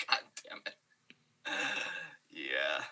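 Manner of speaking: breathy whispered aside. Real, not acted. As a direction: whisper it under your breath. Reacting to dark joke.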